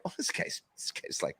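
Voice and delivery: whispered, awed aside; breath-only whisper, retelling amazement; hushed astonishment. Retold or imitated. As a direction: whisper it like a stunned discovery. Retelling his stunned reaction to videos.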